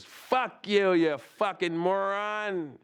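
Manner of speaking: high, slow sneering insult; Daryl's insult. Retold or imitated. Brother's friend insults the gangbanger, slow sneering. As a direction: drag out the moron insult. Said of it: "Turns around and goes".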